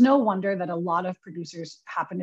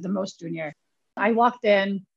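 usual speaking voice

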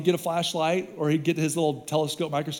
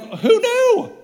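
high, loud, shocked squeak; mock astonishment; 8 dB louder than usual. Preacher retelling honeysuckle danger, high loud astonished. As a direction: act stunned the flowers can kill.

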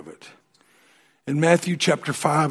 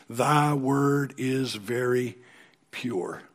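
hushed slow recitation; slow reverent reading; much slower than usual. Acted. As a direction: savour each word of the verse. Reading verse slowly, dropping.

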